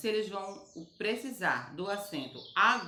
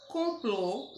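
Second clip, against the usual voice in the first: Complô higher, with PLÔ stressed; stress on final syllable; 4 semitones higher than usual. Shown as a demonstration. Teacher shows pupils the strong syllable, Complô stressed on PLÔ. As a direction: lean on the last syllable of Complô. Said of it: "o clô".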